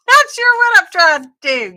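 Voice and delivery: high, loud laughing confession. Real, not acted. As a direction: laugh through the words, voice shooting up. Laughs at her own confusion.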